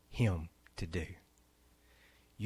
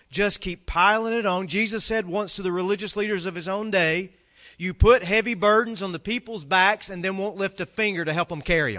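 higher, driving sermon voice; impassioned preaching; 7 semitones higher, 6 dB louder than usual. Acted. Pastor rallying the congregation against religious burdens, fervent. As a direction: build the case with fire.

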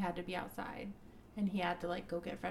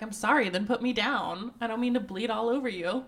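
louder, a hammed-up exasperated apology; mock snippy reply; 2 semitones higher, 9 dB louder than usual. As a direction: snap it back in retelling, louder. Retold snippy heroine reply.

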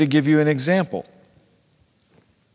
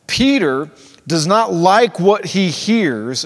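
ordinary talk, nothing marked

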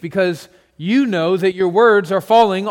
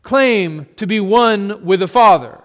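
angry outcry; 2 semitones higher, 6 dB louder than usual. Acted. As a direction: cry out in righteous fury. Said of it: "The man cries out".

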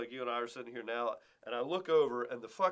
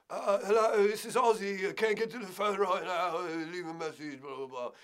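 higher, louder mumbling rock-star greeting; 6 semitones higher, 4 dB louder than usual. Retold or imitated. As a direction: play the voicemail of a hazy rocker.

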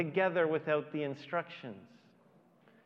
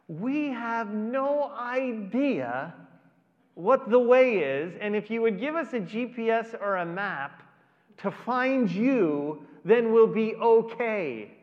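a hint of a baffled disciple, higher and louder, slow in places; confused Thomas; 6 semitones higher, 7 dB louder than usual. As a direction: plead for a map like a lost Thomas. Thomas voiced, confused asking Jesus.

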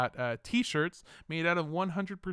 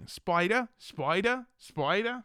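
mock Australian customs agent; 4 semitones higher, slower than usual. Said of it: "That's my impression of an Australian, uh, customs agent".

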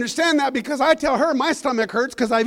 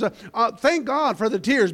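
ordinary talk

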